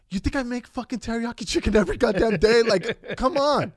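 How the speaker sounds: loud, high, exasperated retort; mock outraged mother, voice climbing; exasperated mom. Mom fed up with daughter's dinner question, exasperated and loud.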